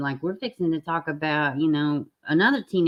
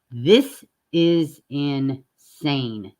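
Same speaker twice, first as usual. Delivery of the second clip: very slow, weighted "This is insane"; drawn-out disbelief; 2 semitones lower, much slower than usual. Real, not acted. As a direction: stretch every word out slowly, stunned. Reacting in disbelief to news story.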